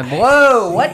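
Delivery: loud high whoa; startled. Pretend. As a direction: jolt awake. Startled waking viewer.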